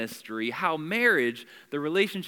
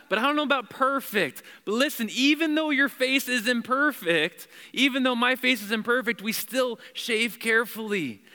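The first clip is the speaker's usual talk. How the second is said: impassioned preaching; 6 semitones higher, 3 dB louder than usual. Real, not acted. Preacher teasing the men, rising up.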